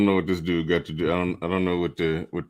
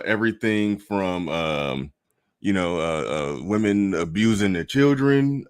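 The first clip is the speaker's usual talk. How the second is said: normal talking voice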